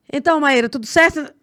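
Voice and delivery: high, loud and pushy hello on the phone; too loud a greeting. Retold or imitated. Lawyer greeting a client on a voice note, too loud and high. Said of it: "não tá tudo certo você com esse tom de voz".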